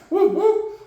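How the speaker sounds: high, playful whoop-whoop; little high-pitched hoot; celebratory cheer. Real, not acted. Cheering on the team.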